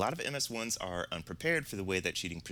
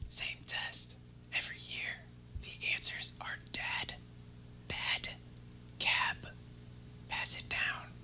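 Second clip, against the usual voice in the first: unvoiced whisper, soft and secretive; classmate whisper; 4 dB quieter, slower than usual. Classmate leaning over during the quiz, secret whisper. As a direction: slip the answers over under your breath. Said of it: "leans over and goes".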